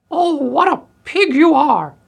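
very high, loud shrieking voice; outraged old woman. A narrator's reading. Old woman scolding the pig that ate her food, shrill screech. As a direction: screech it slowly in indignation.

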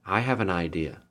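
soft, small and timid; little voice. A narrator's reading. Little girl speaking up from the back of the room, small soft voice. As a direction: pipe up softly from the back. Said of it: "came a little voice saying".

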